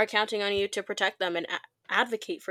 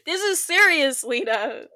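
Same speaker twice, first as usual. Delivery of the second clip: laughing; 8 semitones higher, 7 dB louder than usual. Real, not acted. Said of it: "the one who laughed for about five minutes straight".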